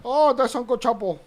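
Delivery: put-on loud copy of a Mexican accent. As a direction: repeat the line in a Mexican voice. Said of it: "put that on the voice machine".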